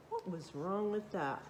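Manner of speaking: usual speaking voice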